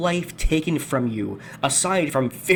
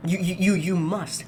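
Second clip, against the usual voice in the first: normal talking voice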